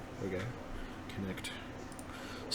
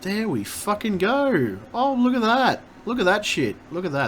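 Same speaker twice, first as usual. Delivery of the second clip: much higher and louder, triumphant; excited relief; 7 semitones higher, 5 dB louder than usual. Real, not acted. Streamer celebrating the program working at last, excited. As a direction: cheer as it finally works.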